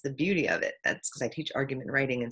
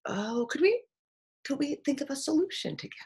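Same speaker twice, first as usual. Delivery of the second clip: gentle suggestion; 10 semitones higher than usual. Retold or imitated. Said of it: "No, it's".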